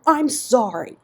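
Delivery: loud, high, blurted apology; desperate outburst. A narrator's reading. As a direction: erupt with it, desperate. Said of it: "Ian erupted desperately".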